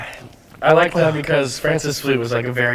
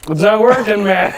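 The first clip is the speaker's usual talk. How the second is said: high, loud desperate cry; 9 semitones higher, 6 dB louder than usual. Real, not acted. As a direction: cry out in burning pain. Bread fails against spice.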